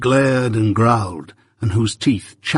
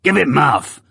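convict's command; 4 semitones higher than usual. Acted. Convict demands.

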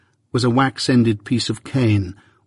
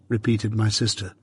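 ordinary delivery, nothing marked

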